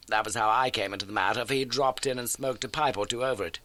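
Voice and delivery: crisp, keen detective laying out facts; Holmes voice. Acted. Holmes briskly telling Watson of the old case.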